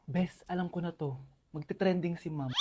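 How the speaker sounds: very soft, low murmur to a friend; student whispering that teacher will go viral; whispered aside. Acted. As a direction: lean over and whisper gossip.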